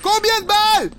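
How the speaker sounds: high loud outraged question; shocked shout. Real, not acted. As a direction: shout it in disbelief. Shocked at enemy toughness.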